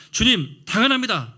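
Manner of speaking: bold, loud declaration; Peter's confident shout; confident loud answer. Retold or imitated. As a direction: proclaim it boldly like proud Peter.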